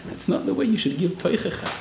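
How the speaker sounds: normal talking voice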